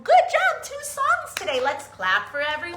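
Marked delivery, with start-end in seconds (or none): 0.0-1.6 excited praise. Real, not acted. Praising kids after singing.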